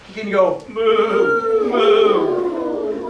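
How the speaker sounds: slow, drawn-out moo like a cow. Shown as a demonstration. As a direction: moo it out for the kids. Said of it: "He can go like a cow".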